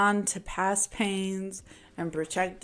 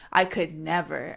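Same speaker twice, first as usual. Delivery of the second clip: ordinary talk, nothing marked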